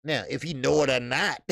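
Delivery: ordinary delivery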